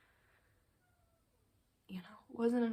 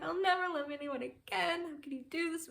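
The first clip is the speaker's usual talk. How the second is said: higher mock wail of betrayal; mock heartbroken drama; 6 semitones higher, 3 dB louder than usual. Mocking the dramatic breakup cliché, higher and hammed up. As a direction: parody a melodramatic jilted lover. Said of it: "it's not like".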